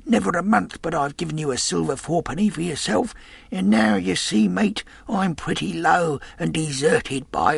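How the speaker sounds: put-on high, wheedling old sailor; old sea captain. A narrator's reading. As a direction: coax the boy like a sick old pirate. Weak captain begs Jim.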